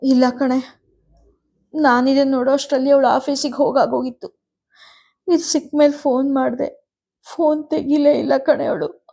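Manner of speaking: mother crying as she explains. A narrator's reading. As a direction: speak through tears. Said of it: "ಎಂದರು ಅಳುತ್ತಾ".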